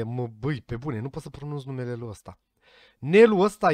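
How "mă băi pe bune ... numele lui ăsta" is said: muttered under breath. Real, not acted.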